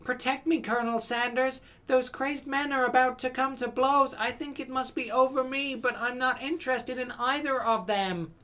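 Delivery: high, sweet, flirty damsel voice; coy flirt. Acted. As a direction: bat your eyelashes at the Colonel. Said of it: "[name]'s tone has completely changed".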